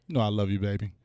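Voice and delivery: tender aside; soft affectionate. Pretend. Tender after teasing.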